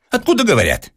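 high irritated demand; an annoyed professor snaps a question into the phone. A narrator's reading. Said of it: "раздражился Персиков". (раздражённо, высоко)

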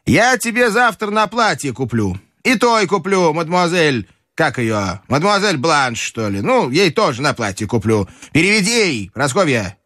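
high-pitched imperious grandmother; a grandmother giving orders, pitched well up; властная бабушка. Acted. Grandmother's figure in story narration. (высокий голос властной бабушки)

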